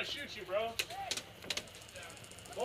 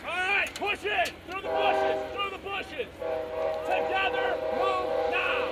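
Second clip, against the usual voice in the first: shouting orders; 7 semitones higher, 8 dB louder, slower than usual. Real, not acted. Leading squad push under fire.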